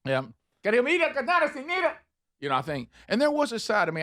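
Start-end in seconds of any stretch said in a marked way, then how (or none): 0.6-1.9 high, gibberish mock Spanish; fake foreign language. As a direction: babble pseudo-Spanish up high. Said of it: "I don't wanna go".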